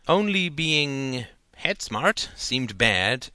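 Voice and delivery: ordinary delivery, nothing marked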